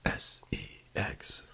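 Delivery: slow, whispered spelling; hushed letters, one by one; mock-sultry whisper. Spelling out the hidden word suggestively.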